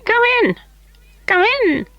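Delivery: very high, piping call; high little elf calling. A narrator's reading. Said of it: "said a high little voice".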